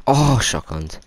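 "shotgunned" yelped high and loud on a falling tune; loud, startled "Oh, shotgunned"; surprised shout. Real, not acted. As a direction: blurt out "shotgunned" in shock. Just got killed by a shotgun.

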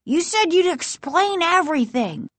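put-on high-pitched child's protest; insist, pitched high; high, complaining insistence; insistent. A narrator's reading. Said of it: "Casey insisted".

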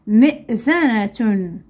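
"مئذنة" slowly, joined into one word; slow whole word. Shown as a demonstration. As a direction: say "مئذنة" carefully without long breaks.

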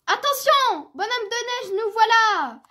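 high, loud warning call; excited shout. A narrator's reading. Little bear on the runaway sledge shouting to the snowman, excited. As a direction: holler it gleefully while sledding downhill. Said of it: "Petit ours brun crie".

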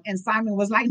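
usual speaking voice

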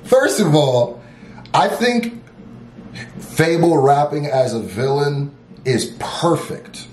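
normal talking voice

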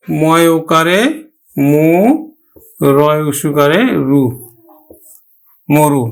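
slow syllables, then whole মরু as "mo-ru"; spelled out. Shown as a demonstration. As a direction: build মরু piece by piece with pauses. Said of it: "তাহলে আমরা উচ্চারণ করব". (মরু বানান করে "মোরু" উচ্চারণ)

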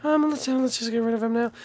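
very high, small character voice; squeaky cartoon voice. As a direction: chirp like a tiny cartoon sidekick.

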